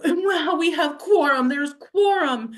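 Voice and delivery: high, loud, hammed-up triumph; mock gleeful exclaim. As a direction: crow it like a smug official. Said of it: "[name] is just acting a fool".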